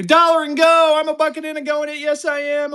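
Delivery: high and loud, hyped bidding cry; excited. Real, not acted. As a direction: shout it out like a thrilled auctioneer.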